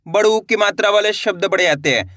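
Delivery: normal talking voice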